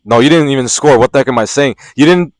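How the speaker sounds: usual speaking voice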